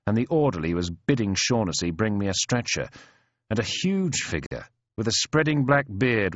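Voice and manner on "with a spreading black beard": usual speaking voice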